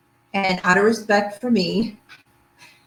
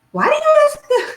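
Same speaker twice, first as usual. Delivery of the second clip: high, mock-incredulous mother voice; a hammed-up doubting mother; imitating mom; 4 dB louder than usual. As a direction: play her skeptical mom, pitched way up. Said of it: "My mom thought I was a little crazy".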